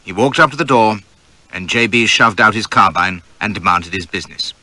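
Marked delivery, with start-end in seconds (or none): none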